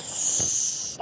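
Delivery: whispered hush; whispered shush. Real, not acted. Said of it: "c'est trop public".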